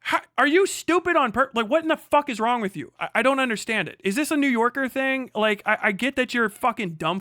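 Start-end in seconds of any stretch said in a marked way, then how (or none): none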